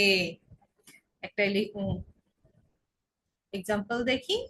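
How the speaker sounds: ordinary talk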